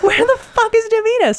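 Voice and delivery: high-pitched frantic demand; alarmed, pitch way up; retold freak-out. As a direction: re-enact a panicked search for a friend. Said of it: "So I'm freaking out".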